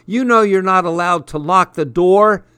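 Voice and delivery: high, loud tattletale scold; bossy rebuke; scolding shout. A narrator's reading. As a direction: sister yelling through the door. Sister pounding on door.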